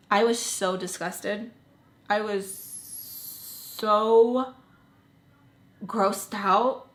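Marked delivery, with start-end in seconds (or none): 2.1-6.9 slow drawn-out. Real, not acted. Drawn out in disgust.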